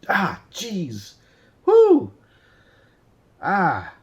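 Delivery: sharp yelps of real pain; burnt fingertips. Real, not acted. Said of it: "I burnt my hand on the hot tweezers".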